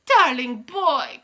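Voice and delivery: slow, drawn-out sugary call; syrupy coo. A narrator's reading. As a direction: coo it with dripping sweetness. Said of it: "cooed the Grand High Wi".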